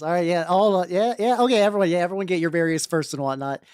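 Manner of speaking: normal talking voice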